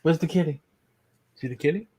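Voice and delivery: fading into a hushed coo to a pet; talking to a dog. Real, not acted. Coaxing the dog.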